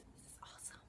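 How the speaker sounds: breathy whispered plug for subscribing. Real, not acted. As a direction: whisper it like a secret.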